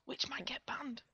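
hushed whisper. Real, not acted. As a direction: breathe it secretly. Repeated, then whispered.

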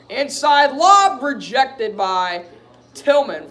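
loud, drawn-out play-by-play call; excited play call. Real, not acted. Commentator calling a rejected lob, excited. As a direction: call the block loudly, stretching it out. Live call of a blocked shot.

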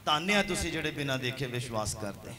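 ordinary talk

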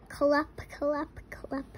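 whispered clip-clop hoof sounds; horse hooves. Shown as a demonstration. Parent reading to a toddler, whispering horse hooves. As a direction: tap out hooves under the breath. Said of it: "He can sound like horse feet".

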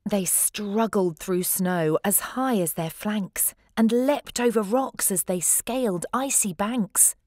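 usual speaking voice

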